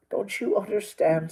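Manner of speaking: ordinary delivery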